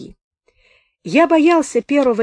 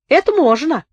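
eager consent, higher in pitch; willing agreement; 7 semitones higher, 5 dB louder than usual. A narrator's reading. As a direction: agree readily, lifting the voice. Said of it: "охотно согласился Сухолицый". (охотно соглашаясь, голосом повыше)

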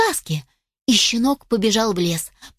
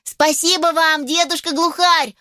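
high, overjoyed puppy thanks; joyful puppy; 7 semitones higher than usual. A narrator's reading. Puppy thanks the rescuing old grouse, joyfully. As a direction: cry out thanks with delight. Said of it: "радовался Тузик".

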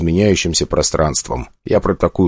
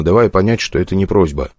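usual speaking voice

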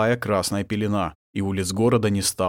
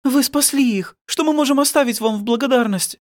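very high woman's character voice; high, emotional wife's voice; grateful woman; 4 dB louder than usual. A narrator's reading. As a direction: thank the rescuers fervently. Grateful wife thanks the rescuers.

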